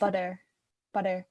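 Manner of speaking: "butter" with a soft D in place of T. Shown as a demonstration. Host tries out the guest's point, "butter" with a D. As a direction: echo "butter" with its T softened to D. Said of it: "the T is more a D".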